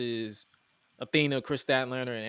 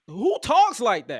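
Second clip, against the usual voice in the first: incredulous; 7 semitones higher, 9 dB louder, slower than usual. Real, not acted. Incredulous outburst about the odd slang.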